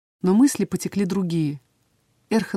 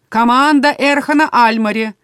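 louder, insistent announcer call; demanding; 6 semitones higher, 8 dB louder than usual. A narrator's reading. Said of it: "прозвучало уже требовательно".

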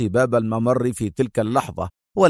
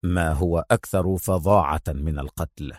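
low, understated sharpness; sharp answer; 6 semitones lower, 4 dB quieter than usual. A narrator's reading. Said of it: "أجابها في حدة".

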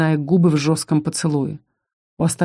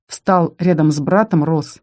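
ordinary delivery, nothing marked

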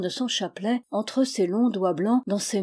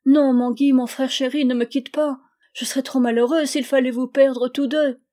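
higher, louder anguished plea, read in character; acted distress, imploring him to stay; tearful pleading; 5 semitones higher, 6 dB louder than usual. A narrator's reading. Sister begging her brother not to leave, tearful pleading. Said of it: "Les sanglots d'Enora redoublèrent".

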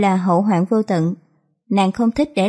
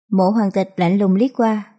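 plain reading voice

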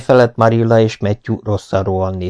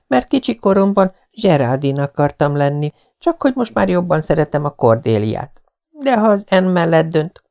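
put-on higher, begging girl's voice; pleading young girl; 7 semitones higher than usual. A narrator's reading. Anne begs Marilla to call her Cordelia. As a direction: coax the grown-up sweetly.

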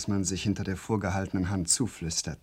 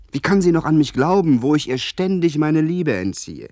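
despairing cry; 8 semitones higher, 9 dB louder than usual. Acted. Despairing lament over Sybil.

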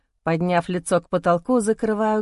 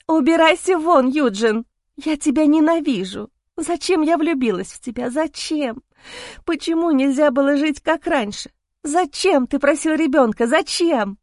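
hysterical screaming; 7 semitones higher than usual. Acted. Wife screams at husband.

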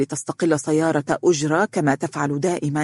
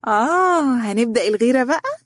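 high-pitched, mischievous needling; sly teasing; 8 semitones higher than usual. A narrator's reading. Abeer laughingly accuses Azza of jealousy, slyly. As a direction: tease her with a knowing, raised lilt. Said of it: "ضحكت عبير وقالت بخبث".

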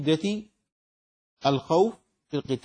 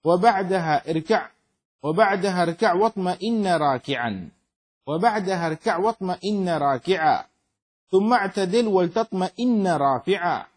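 higher, ringing metrical recitation; verse recitation; 3 semitones higher, 4 dB louder than usual. Acted. Teacher reading the poem on prayer pillars, raised measured recitation. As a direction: recite in a firm, rhythmic lilt.

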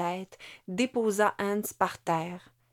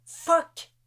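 sharp, much higher swear; cursing cry; 9 semitones higher, 5 dB louder than usual. A narrator's reading. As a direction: spit the curse out. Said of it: "cria un autre soldat".

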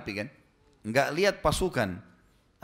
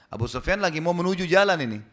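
ordinary talk, nothing marked

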